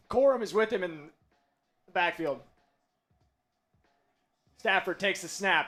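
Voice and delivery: usual speaking voice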